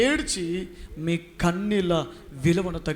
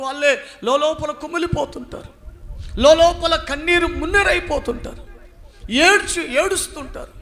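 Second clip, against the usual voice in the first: climbs higher and louder over the hidden tears; rising pity; 9 semitones higher, 4 dB louder than usual. Acted. Preacher describing people who weep alone, rising pity. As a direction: swell with pity for the silent weepers. Preacher pictures secret weeping.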